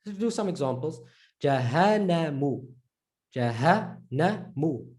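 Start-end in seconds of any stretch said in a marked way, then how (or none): 1.4-2.6 Jahanamu slowly, with short N; single N. Shown as a demonstration. Teacher reading an example before shadda, single N. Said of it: "Add the shadda". 3.3-4.9 Jahanamu in slow separate syllables. Shown as a demonstration. Teacher breaking down an example, syllable by syllable. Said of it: "Add the shadda".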